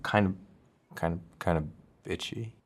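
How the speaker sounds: quiet, halting and reluctant; hesitant admission. Acted. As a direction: let it out softly, bit by bit. Awkwardly answers what she was like.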